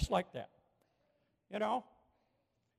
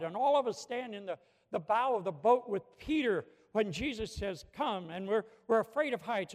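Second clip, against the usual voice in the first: louder, rhythmic pulpit build; full-force preaching; 6 dB louder than usual. Acted. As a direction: drive each "we hold on" home to the congregation.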